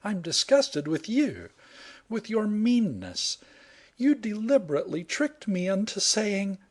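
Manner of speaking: high, cross woman's voice; accusing heroine. Acted. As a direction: accuse him of meanness.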